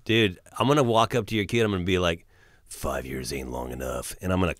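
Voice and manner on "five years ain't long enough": low, soft menacing trailer line; dramatic movie voice. Pretend. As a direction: growl it like a film threat. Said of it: "trying to be dramatic".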